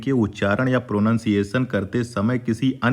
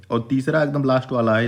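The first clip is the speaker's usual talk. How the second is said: ordinary talk, nothing marked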